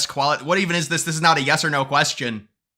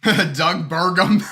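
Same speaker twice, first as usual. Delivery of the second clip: laughing; 3 semitones higher, 5 dB louder, much slower than usual. Real, not acted. Laughing at chat message.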